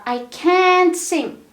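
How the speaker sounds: "can't" loud, high and drawn out; stress on CAN'T. Shown as a demonstration. English teacher giving a negative example, strong stress on "can't". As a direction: hit and stretch the word "can't". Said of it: "the stress goes to the modal verb".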